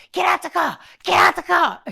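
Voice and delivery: imitated high-pitched order; mock angry shout. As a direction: shout it like an irate passenger. Imitates angry man ordering driver out.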